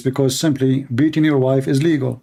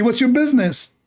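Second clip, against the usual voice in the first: put-on Saudi accent; 8 semitones higher than usual. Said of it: "in Saudi accent".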